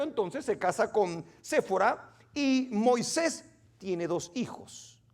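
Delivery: normal talking voice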